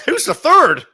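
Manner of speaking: high, slow, hammed-up squeal through a laugh; playfully high-pitched mock question. Mocking correction of partner's joke.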